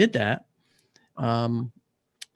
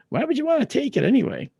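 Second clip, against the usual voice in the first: high-pitched mock bafflement; puzzled; 12 semitones higher, 3 dB louder than usual. Doctor questioning his patient's request, puzzled.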